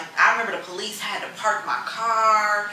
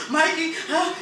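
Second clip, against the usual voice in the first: mock sob; 11 semitones higher than usual. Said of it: "I'm like".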